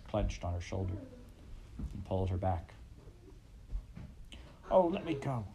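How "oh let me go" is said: frightened plea. A narrator's reading. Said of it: "Laura screamed in a whisper".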